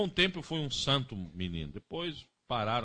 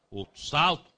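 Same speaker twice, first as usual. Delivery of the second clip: usual speaking voice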